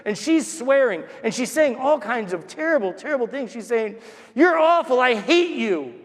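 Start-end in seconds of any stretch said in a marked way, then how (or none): 4.3-5.0 loud, high-pitched berating; imitated angry shouting. Big lady cursing at her child, loud imitated shouting. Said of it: "and she's shouting". 5.0-6.0 raised, higher voice of hateful scolding; imitated angry shouting. Mother berating her little boy on the street, imitated shouting. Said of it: "and she's shouting".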